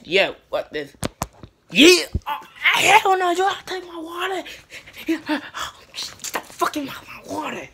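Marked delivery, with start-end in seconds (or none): 1.7-4.5 outraged shout. Acted. Sudden outburst at water theft. 5.9-7.7 whisper. Acted. Hissed complaint.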